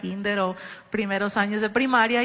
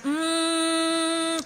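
hum; 5 semitones higher than usual. Retold or imitated. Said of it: "como si estuviera tratando de agarrar el tono de una canción".

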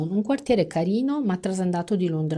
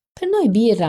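louder call across the table; a higher, louder call for beer; shouted order; 7 semitones higher, 7 dB louder than usual. A narrator's reading. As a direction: shout it to be heard. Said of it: "gridò Fran".

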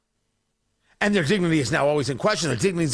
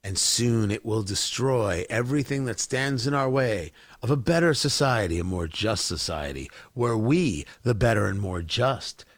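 sinister villain voice; 9 semitones lower, 4 dB quieter than usual. Retold or imitated. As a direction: savour every word like a cartoon villain plotting doom. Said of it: "So they can sit back and go".

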